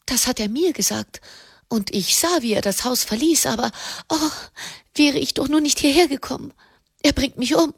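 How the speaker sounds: high, anxious woman's voice; panicked. A narrator's reading. Wife terrified that Ascanio will find her, panicked. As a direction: let the panic climb and quicken towards the end. Frightened wife in fiction, acted.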